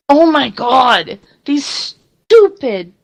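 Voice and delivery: slow, high-pitched venting, half-playful; mock rant. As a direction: ham up a fed-up rant about stupid people. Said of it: "I could choose to be mad as hell".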